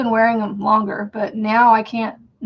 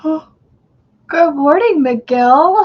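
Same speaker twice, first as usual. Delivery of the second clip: drawn-out greeting; 6 semitones higher, 9 dB louder, much slower than usual. Real, not acted. Greeting a viewer by name.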